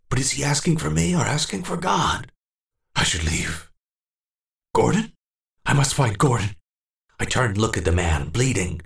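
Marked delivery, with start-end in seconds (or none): 0.1-6.6 high, worried calling; frightened old man torn between helping and fleeing; torn and anxious. Acted. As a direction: call for Gordon nervously.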